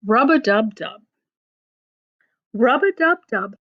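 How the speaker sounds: ordinary delivery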